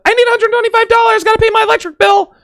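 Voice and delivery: put-on quick, very high falsetto girlfriend; rushed, hammed-up high-pitched plea; mock girlfriend. Girlfriend calling up for bill money, fast mock falsetto. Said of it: "Your girl calls up".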